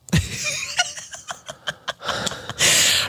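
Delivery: long high breathy laugh. Real, not acted. Laughing at the warning the chat will vanish, long high laugh. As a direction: laugh it off at length.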